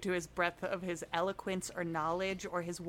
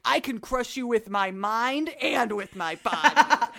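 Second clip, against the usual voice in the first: loud, higher-pitched mock wrestler brag; wrestler's boast; 4 semitones higher, 7 dB louder than usual. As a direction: boast like a wrestler cutting a promo. Acting Plato boasting as a wrestler.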